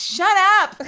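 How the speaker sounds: loud, high mock yell of shut up; joking shut up yelled at chat; playful shout. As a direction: snap at chat in fake outrage.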